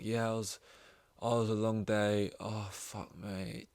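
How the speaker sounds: drained, quiet and trailing off; a worn-out worker barely getting the words out; weary sigh. Pretend. The dog as a worker home from a long day, quiet and drained.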